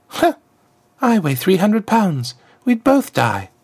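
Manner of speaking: snort, then dismiss the idea; snorting girl. Acted. Freed girl scoffs at riding on Azriakam's back, high and scornful. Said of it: "The girl snorted".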